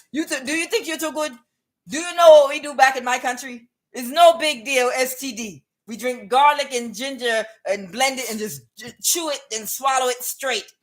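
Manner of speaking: badly faked, animated Cameroonian accent; attempted Cameroonian accent. West African boasting of home remedies, attempted Cameroonian accent. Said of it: "I can't do the accents tonight".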